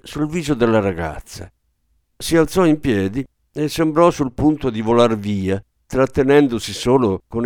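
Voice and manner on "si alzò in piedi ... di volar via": ordinary delivery, nothing marked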